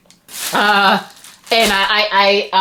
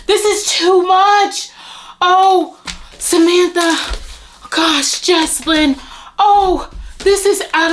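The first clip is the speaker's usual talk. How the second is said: high, slow, near tears; choked with emotion; overcome; 10 semitones higher, slower than usual. Real, not acted.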